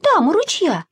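put-on high, eager little-girl voice; excited call. A narrator's reading. Masha shows Sasha the flowers by the stream. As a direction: call and point it out. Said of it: "крикнула Маша".

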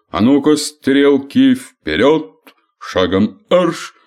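loud drill-sergeant orders; командирский голос. Acted. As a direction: bark it like a parade drill. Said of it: "скомандовал". (громко, по-командирски)

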